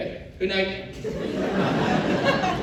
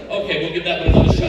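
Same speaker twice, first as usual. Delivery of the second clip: fast, louder self-pep talk; breathless resolve; 5 semitones lower, 7 dB louder, faster than usual. Retold or imitated. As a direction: rush it out before the next breath. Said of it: "you're like".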